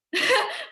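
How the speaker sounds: a burst of high laughter. Real, not acted. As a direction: laugh out loud at the joke. Laughs after Netflix joke. (tertawa lepas dengan nada tinggi)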